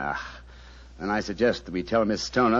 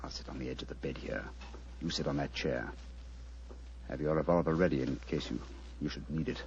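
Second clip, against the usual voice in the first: low, softer instructions in the dark; hushed; 5 semitones lower, 7 dB quieter than usual. Acted. Holmes giving Watson hushed orders for the vigil. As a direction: murmur the orders so nothing carries.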